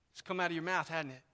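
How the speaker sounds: ordinary talk, nothing marked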